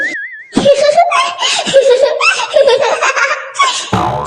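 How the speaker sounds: long burst of high-pitched laughter; mocking laughing fit. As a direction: crack up at someone, unable to stop. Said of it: "तुम चुप हो रही हो या नहीं?".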